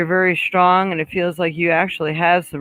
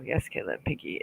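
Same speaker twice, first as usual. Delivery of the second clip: breathy whisper, much softer, no voice; 12 semitones lower, 13 dB quieter than usual. Real, not acted. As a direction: read it under your breath, almost silent. Re-reading comment under breath.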